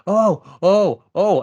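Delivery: high, loud stunned oh, oh, oh; shocked gasping oh. Retold or imitated. Fan retelling a shock twist, high and loud stunned gasps. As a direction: gasp it out like you can't believe it. Shocked reaction to the stabbing scene.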